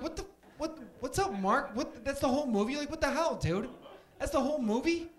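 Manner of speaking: high, loud, incredulous rant; mock exasperation. Moviegoer complaining to Mark about the film, loudly exasperated. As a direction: protest like a baffled, let-down moviegoer. Acting out exasperated viewer's reaction.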